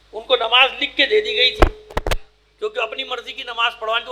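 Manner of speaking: ordinary talk, nothing marked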